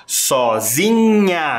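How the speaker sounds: Sozinhas drawn out slowly, syllable by syllable; slow syllabified. Shown as a demonstration. Teacher modelling a word slowly for pupils. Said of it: "Olha lá, ó".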